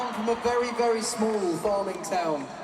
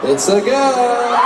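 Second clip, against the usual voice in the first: loud, slow, drawn-out shout of joy; excited shout; 3 semitones higher, 8 dB louder, much slower than usual. Real, not acted. Singer announcing a gender reveal to the stadium, joyful shout. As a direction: call it out to the whole stadium.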